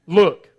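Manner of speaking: a sharp emphatic look; haughty look. Retold or imitated. Son snapping at his father, emphatic. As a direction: snap it at dad. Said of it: "that's the exclamation point".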